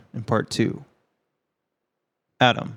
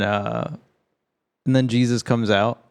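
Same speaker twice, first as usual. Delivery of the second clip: ordinary talk, nothing marked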